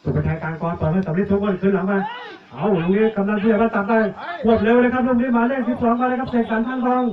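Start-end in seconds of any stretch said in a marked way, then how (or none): 1.7-7.0 high-pitched rush of match commentary; real commentator excitement; excited play-by-play. Real, not acted. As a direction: call the fast break, voice lifted and pushed. Live call of a fast break.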